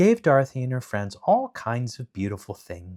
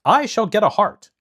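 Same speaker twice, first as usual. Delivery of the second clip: eager Tin Woodman; 12 semitones higher, 9 dB louder than usual. Acted.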